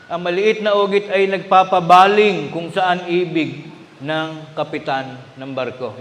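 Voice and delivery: solemn scripture reading from the pulpit. Acted. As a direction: read the verse out to the congregation. Reads the Bible verse aloud.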